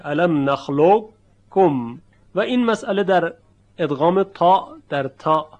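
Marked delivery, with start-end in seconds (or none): 0.0-2.0 slow recited الم نخلقکم, heavy ق colour before a doubled ک; heavy qaf kept. Shown as a demonstration. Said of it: "اون صدای درشت قبل از تلفظ کاف شنیده میشه".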